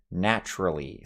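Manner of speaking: ordinary talk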